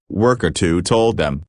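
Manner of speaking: ordinary delivery, nothing marked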